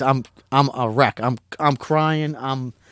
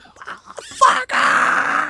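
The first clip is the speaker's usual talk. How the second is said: very high triumphant shout; ecstatic yell. Retold or imitated. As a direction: let it rip in pure joy. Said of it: "Just losing it".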